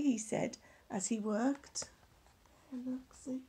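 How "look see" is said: whispered aside. Real, not acted. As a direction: point and whisper it. Aside pointing out the picture.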